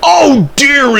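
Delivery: high, loud burst of alarm; flustered exclamation. A narrator's reading. Thomas wants out of the haunted shed, high flustered exclamation. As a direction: exclaim it, pitch leaping up. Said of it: "exclaimed Thomas".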